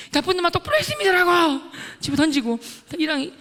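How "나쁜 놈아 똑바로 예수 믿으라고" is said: loud, high shouted rebuke; angry scolding. Retold or imitated. Scolding a classmate to believe properly, acted angry shout. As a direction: yell it as a furious order, acted.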